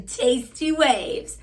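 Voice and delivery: very slow stoner surfer drawl; Jeff Spicoli. Retold or imitated. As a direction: stretch it out like a dazed surfer. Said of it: "sounds like he's Jeff Spicoli".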